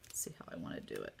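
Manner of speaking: whispered aside to herself; whispering. Real, not acted. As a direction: breathe it almost silently. Muttering to herself mid-craft.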